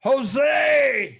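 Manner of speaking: loud, high call of a name. Real, not acted. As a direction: call his name across the room. Greeting a friend arriving.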